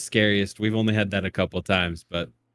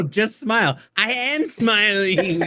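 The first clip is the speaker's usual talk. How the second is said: higher, slow forced-smile whine; a kid straining to prove he's smiling; kid insisting; 9 semitones higher, slower than usual. Retold or imitated. Child insists mid-photo, high and drawn out. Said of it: "You're like".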